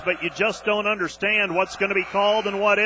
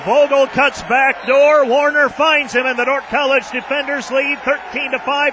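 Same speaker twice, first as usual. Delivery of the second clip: louder, higher, roaring the dunk call; excited play-by-play; 5 semitones higher, 5 dB louder than usual. Real, not acted. Radio announcer calling a home-team dunk, excited shout. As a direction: sell the slam like the crowd just erupted.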